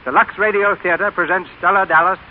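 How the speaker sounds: bright, formal announcer introducing the cast; radio announcer. Acted. Announcer introducing tonight's radio play to listeners, formal and bright. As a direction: present the show to the radio audience.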